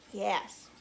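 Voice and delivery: plain reading voice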